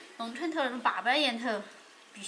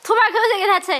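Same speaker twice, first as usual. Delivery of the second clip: loud high teasing; 9 semitones higher, 12 dB louder, faster than usual. Real, not acted. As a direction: call out teasingly. Teasing loudly.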